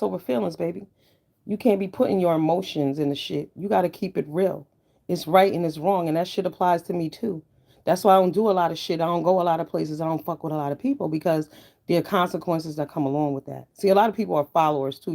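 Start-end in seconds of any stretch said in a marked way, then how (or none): none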